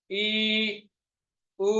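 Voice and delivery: ordinary talk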